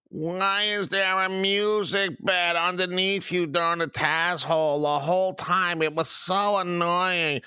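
high, drawn-out whiny complainer; mock whining. Host mimicking listeners who moaned about background music, mock whining. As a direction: imitate moaning listeners in a sustained high whine. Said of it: "bitched, moaned, complained".

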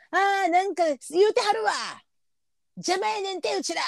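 high voiced chatty old woman, grumbling aside; high old lady gossip voice; elderly lady. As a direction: mimic an old lady muttering to her friend. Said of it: "みたいなさ".

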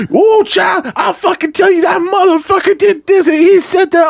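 very high-pitched, worked-up older lady impression; imitated irate caller, high and indignant; pissed-off lady caller. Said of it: "they would get so pissed off".

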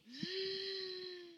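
sharp high in-breath gasp; quick showy gasp; demo gasp. Shown as a demonstration. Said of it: "as in".

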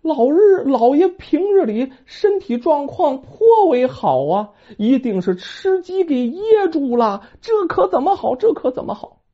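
高嗓门女人腔，慌乱发急; 急得团团转地念叨; 着急的地主婆. Acted. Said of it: "着急说". (地主婆着急地念叨)

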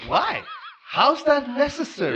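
high-pitched, indignant disbelief; incredulous protest. Acted. Protesting to co-host about colonial history lessons, incredulous.

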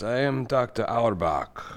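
usual speaking voice

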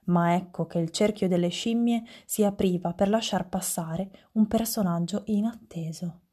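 ordinary delivery

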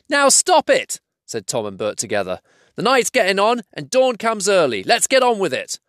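two trolls hushing the quarrel, high and impatient. Acted. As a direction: tell them off in a high troll voice. Tom and Bert together.

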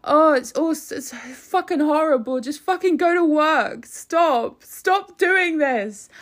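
loud, high-pitched hammed-up whinge; mock whining. Commentator mimicking a minister griping about striking workers, mock whining. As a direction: imitate a petulant moaner, high and loud. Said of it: "he's just whinging and whining".

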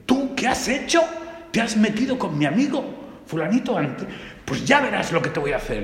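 put-on play-yell at the bug to calm the kids; mock angry shout. Teacher hamming up shouting at a bumblebee for the class. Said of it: "me puse a gritarle".

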